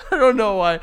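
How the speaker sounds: laughing through it. Real, not acted. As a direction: chuckle the words out.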